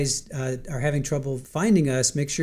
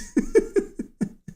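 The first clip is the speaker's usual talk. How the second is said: squealing high laugh. Real, not acted. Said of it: "I'm laughing so hard".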